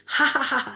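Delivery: mock belly laugh in short bursts; imitated loud laughter. Preacher showing how the devotee laughed in ecstasy, imitated laughter. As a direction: demonstrate laughter as a string of ha-has. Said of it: "she would laugh loudly".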